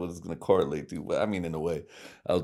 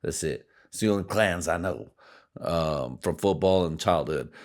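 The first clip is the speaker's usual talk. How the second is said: ordinary talk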